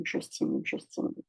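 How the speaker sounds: je stimule trailing off into a breathy whisper; fading to a whisper. Shown as a demonstration. A coach tapping along, trailing off into a whisper. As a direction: let je stimule fade to a whisper while tapping.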